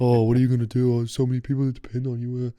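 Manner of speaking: put-on teasing voice of mock dismay; mock someone's worries like a teasing coach. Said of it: "mock me".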